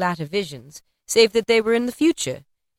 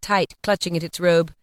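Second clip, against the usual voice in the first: ordinary delivery, nothing marked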